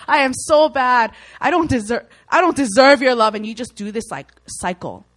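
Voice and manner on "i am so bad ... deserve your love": loud, pleading self-reproach; guilty self-condemnation. Retold or imitated. Repentant believer telling God they are bad, loud guilty plea. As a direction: beat yourself up before God. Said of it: "sit there and be like".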